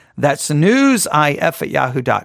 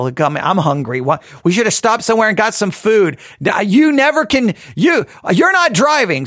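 complaining passengers, climbing into a higher squabble; mock grumbling; 5 semitones higher than usual. Hungry passengers grumbling at the driver, building to mock arguing. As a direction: act out bickering passengers, rising in pitch. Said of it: "everyone's grumbling now".

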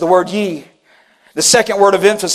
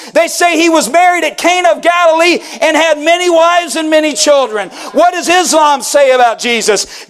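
fervent preacher, raised and driving; preaching at full force; 6 semitones higher than usual. Acted. Pastor pressing the church with a fervent call. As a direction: press the question on the congregation. Preacher listing other beliefs.

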